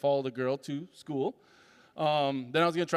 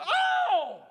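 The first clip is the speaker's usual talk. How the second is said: high startled yelp; startled scream. Retold or imitated. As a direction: jump at someone walking in, yelp. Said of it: "I totally just screamed".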